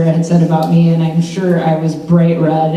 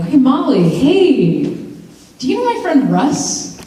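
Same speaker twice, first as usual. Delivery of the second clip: higher, slow, sing-song casual voice; fake-casual matchmaker; 7 semitones higher, slower than usual. Sounding out Molly for her friend Russ, high and breezy. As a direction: play it breezy while fishing for info. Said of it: "you go over and you're like".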